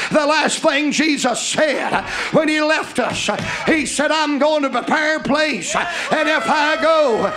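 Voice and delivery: loud, rhythmic preacher's cadence rising to a cry; full-force preaching. Acted. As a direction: preach it out to the whole church.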